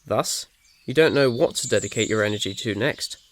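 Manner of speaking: ordinary delivery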